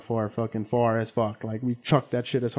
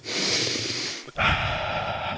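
long, audible breath out; deep sigh; 5 dB quieter than usual. Retold or imitated. Imitating the officer's deep breath before she speaks, long sigh. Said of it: "She takes a deep breath".

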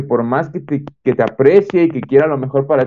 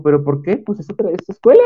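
imitating mom, pitched up high; mock incredulous mother, voice climbing high; dismissive mother; 5 semitones higher than usual. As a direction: play the mom brushing off his nerves. Mother brushing off his nerves.

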